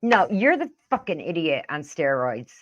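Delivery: ordinary delivery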